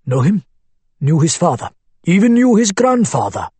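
put-on Arabic accent. A narrator's reading. Abu Hamad's voice.

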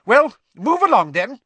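teacher hurrying a soaked crowd along; sharp McGonagall. Acted. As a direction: snap at the crowd. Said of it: "said Professor McGonagall sharply".

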